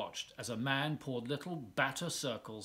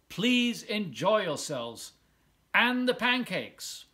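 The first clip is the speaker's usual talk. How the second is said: higher, slow and beaming like a mayor at a podium; cheerful mayor; 6 semitones higher, 4 dB louder, slower than usual. Acted. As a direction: invite the crowd warmly, drawn out. Mayor closes his speech.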